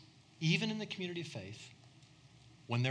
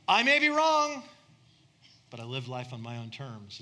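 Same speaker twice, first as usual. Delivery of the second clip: defiant maverick shout; 2 semitones lower, 8 dB louder than usual. Retold or imitated. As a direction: belt it out like a stubborn loner. Maverick quote, acted defiant.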